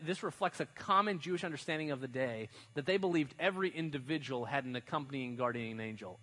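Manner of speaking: ordinary delivery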